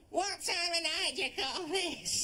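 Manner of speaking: a lightly voiced nagging Jenny; mock a scolding partner, drawn out; Jenny impression. Shown as a demonstration. [name] showing how Jenny scolds, a hint of nag. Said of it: "hold your hands out like that and go".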